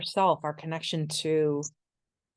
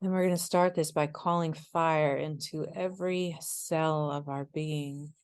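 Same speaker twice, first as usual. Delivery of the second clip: guided meditation; 4 dB quieter than usual. Acted. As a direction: ease them into stillness, unhurried and hushed.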